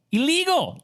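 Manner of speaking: high, incredulous stretch on illegal; mock outrage at the law; indignant emphasis. Real, not acted. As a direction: land the word like it's absurd.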